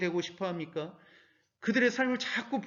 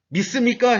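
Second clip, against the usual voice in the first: loud, raised call to the congregation; quoted preacher's challenge; 5 semitones higher, 9 dB louder than usual. Retold or imitated. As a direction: call it out like a preacher rallying believers. Preacher's rallying question, quoted.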